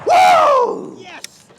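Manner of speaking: very high, loud victory whoop; triumphant whoop. Real, not acted. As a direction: let out a wild cheer. Celebrating a successful shot.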